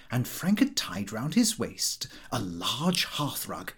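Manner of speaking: usual speaking voice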